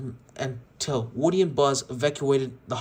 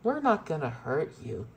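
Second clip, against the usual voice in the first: creepy tone. Acted. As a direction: tease them with sick sweetness. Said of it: "in a creepy tone".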